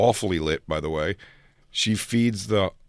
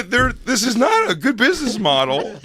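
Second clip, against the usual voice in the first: high mock complaint; 11 semitones higher, 3 dB louder than usual.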